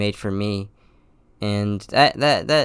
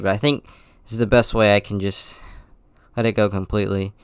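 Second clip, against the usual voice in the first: ordinary delivery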